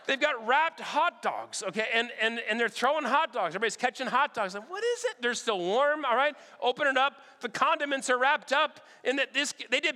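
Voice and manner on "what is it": surprised. Retold or imitated. Catching thrown hot dogs.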